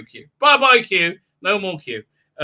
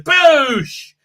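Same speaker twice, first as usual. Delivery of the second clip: high, loud mouth-made blaster boom; gun blast sound; 10 semitones higher, 6 dB louder than usual. Retold or imitated. Woman firing at security guards: loud mouthed boosh. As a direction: spit out a blaster shot.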